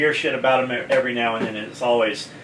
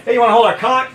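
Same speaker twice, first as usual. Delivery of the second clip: higher, louder playful come-on; cheeky loud pitch; 8 semitones higher, 6 dB louder, faster than usual. Retold or imitated. Pitching to Chester's wife.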